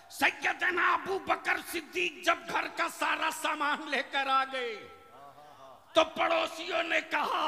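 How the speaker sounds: high-energy, projected preacher's narration; forceful sermon storytelling. Real, not acted. Preacher telling how Abu Bakr gave everything away, forceful preaching. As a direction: declaim the tale to the whole crowd.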